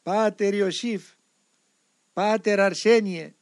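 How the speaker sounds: high, loud, drawn-out call across the rocks; slow, loud call for the monks; calling out names in the mountains, high and slow. Retold or imitated. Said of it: "αναγκάστηκα κάθε τόσο να φωνάζω".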